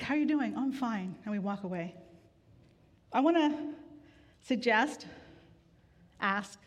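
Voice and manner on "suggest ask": ordinary delivery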